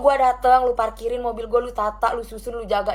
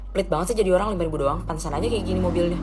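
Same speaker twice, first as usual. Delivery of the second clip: attendant sneering, pitched lower; mock his sneer in a lower voice; parking attendant mocking the driver, lower sneering voice; 6 semitones lower, 3 dB quieter than usual.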